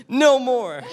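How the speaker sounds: high, loud playful outburst; mock defiance. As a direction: put your foot down, hammed-up. Mock defiance about taxes.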